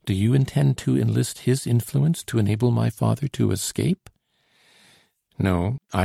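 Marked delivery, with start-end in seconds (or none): none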